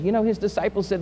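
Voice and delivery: ordinary delivery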